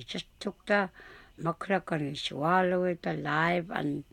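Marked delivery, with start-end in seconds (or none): none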